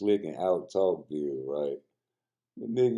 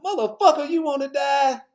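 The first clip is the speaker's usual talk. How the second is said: much higher and louder, hammed-up threat; mock shouted threat; 8 dB louder than usual. Comedian quoting Richard Pryor pulling a gun, mock yell. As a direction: bark it like a movie gunman.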